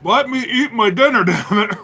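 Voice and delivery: high, laughing protest. Real, not acted. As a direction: laugh through the complaint. Joking protest while eating.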